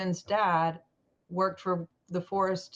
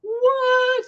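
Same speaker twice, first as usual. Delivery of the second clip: stunned what; 10 dB louder than usual. Retold or imitated. Reacting to the painting's meaning.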